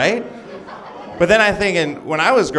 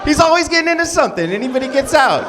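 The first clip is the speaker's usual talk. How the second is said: much higher, loud, almost shouting; gushing excitement; 13 semitones higher, 5 dB louder than usual. Acted. Churchgoer gushing about the latest church episode, excited and shrill. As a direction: squeal it like a superfan.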